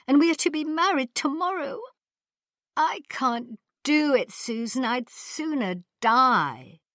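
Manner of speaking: a bride dreading her wedding, near tears; wailing plea. Acted. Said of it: "she wailed".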